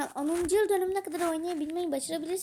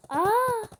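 sudden high delighted "Aa!"; a bright burst of surprise, louder and higher; surprised gasp; 7 semitones higher, 7 dB louder than usual. Real, not acted. Thrilled at the game item, a high loud gasp.

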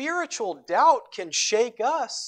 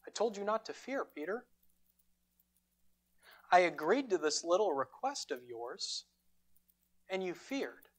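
hushed rebuke; 7 dB quieter than usual. Pretend. Imagined stern Jesus rebuking Peter.

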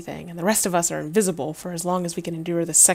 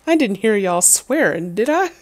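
loud put-on country accent; country accent, pitch leaping up on the question; 3 semitones higher, 7 dB louder than usual. Bonfire captain questions campers about swearing, in a country accent. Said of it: "I can't not do a country accent".

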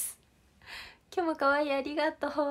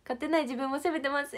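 usual speaking voice

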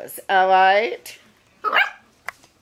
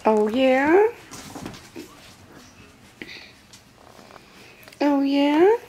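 much higher, rising cooing pet voice; 9 semitones higher, much slower than usual. Real, not acted. Nana answers a puppy's noises in high cooing baby talk. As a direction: coo playfully at the pup.

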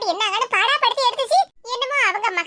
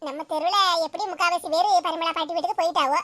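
normal talking voice

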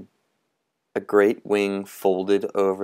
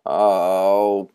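mournful sigh; 5 dB louder than usual. Acted. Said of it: "mourned Mister Mountrout".

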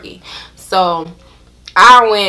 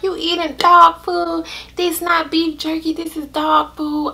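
put-on higher, teasing back-seat girl; mocking friend; 8 semitones higher than usual. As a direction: laugh at her for eating dog food. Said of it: "a girl in the back was like".

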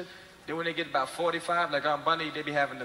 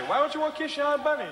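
mother's voice; 9 semitones higher than usual. Retold or imitated. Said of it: "Your mother say".

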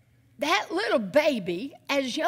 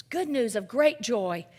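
ordinary talk

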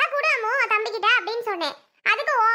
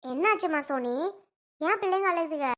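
grandmother; 4 semitones lower, 5 dB quieter than usual. Acted. Grandmother arrives, asks about crying.

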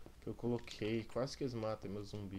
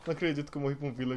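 laughing; 7 dB louder, much faster than usual. Real, not acted. Laughing after dying.

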